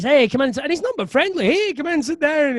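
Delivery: Piper welcoming. Retold or imitated. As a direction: welcome him in with big cheer.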